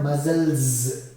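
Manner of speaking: MUSCLES with a loud held z ending; muscles, final s said as z; ending sound demo. Shown as a demonstration. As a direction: stress the buzzing z closing muscles. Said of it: "it becomes za".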